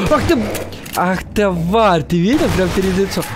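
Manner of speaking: spooked by a monster; startled. Real, not acted. Monster jumps at face.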